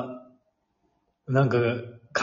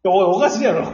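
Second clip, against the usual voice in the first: indignant through laughter; laughing protest; 7 semitones higher, 5 dB louder than usual. Real, not acted. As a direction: laugh while objecting. Friend's false accusation. (笑いながらツッコむ)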